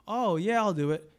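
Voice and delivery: loud, high, over-eager yes; hammed-up willing colleague; feigned agreement. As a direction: agree much too keenly to a coworker. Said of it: "We say one thing".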